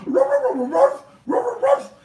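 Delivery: high, loud yappy barks; rapid string of high barks; dog barking. Acted. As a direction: bark like a little dog reading lips. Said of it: "And Rover says".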